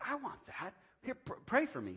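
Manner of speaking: high-pitched re-enacted eagerness; eager request. Listener asks the young man to pray for him, eager imitated request.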